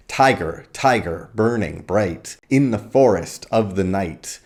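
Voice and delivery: exclaimed beats on "Tiger, tiger, burning, bright", each set apart; emphatic stressed syllables. Shown as a demonstration. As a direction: punch the first syllable of each "Tiger" and land hard on "bright". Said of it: "which emphasizes these stresses".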